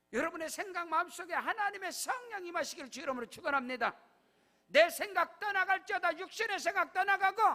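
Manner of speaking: loud, high pulpit proclamation; full-force preaching. Acted. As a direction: decree it over the congregation. Preaching blessing and decree. (강단에서 외치는 설교)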